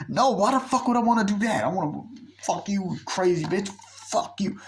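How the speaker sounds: mock angry rant. Friend yelling at girlfriend.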